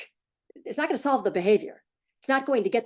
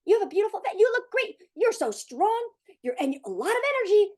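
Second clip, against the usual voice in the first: put-on high, bright-eyed praise for the kid, much higher; energized praise; 12 semitones higher than usual. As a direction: gush over your kid like a pepped-up cheerleader. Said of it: "very energized, energized way".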